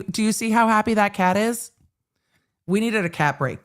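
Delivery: ordinary talk, nothing marked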